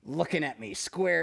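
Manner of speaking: usual speaking voice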